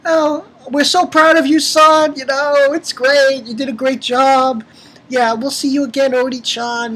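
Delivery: high, gushing parent voice, imitated; mock-proud parents, pitched high. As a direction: play doting parents praising their son. Voices parents in a video message.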